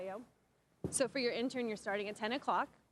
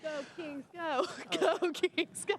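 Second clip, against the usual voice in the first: high excited team chant; sports fan cheer; 7 semitones higher than usual. Real, not acted. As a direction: cheer for the Kings.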